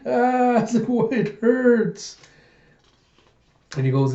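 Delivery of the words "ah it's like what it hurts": high-pitched wail of pain; mock crying. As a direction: wail like a hurt child, hammed-up. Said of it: "he's crying, goes".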